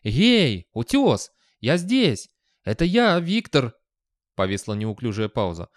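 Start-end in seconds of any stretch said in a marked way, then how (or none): 0.0-3.7 calling loudly, pitched up; shouting out. Acted. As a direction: yell to be found under the rocks. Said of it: "вскричал Виктор".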